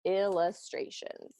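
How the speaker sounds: slow drawn-out word; slow, quiet dictation; spoken while writing. Shown as a demonstration. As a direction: stretch the word out as you write it. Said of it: "So I'm gonna write that".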